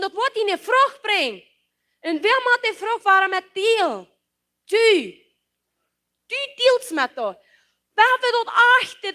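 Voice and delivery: ordinary talk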